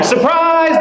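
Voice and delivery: bright party surprise yell, high; mock happy party greeting; cheerful surprise. Party guests greeting the president, cheery high surprise. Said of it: "instead of, like".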